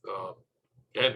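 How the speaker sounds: ordinary delivery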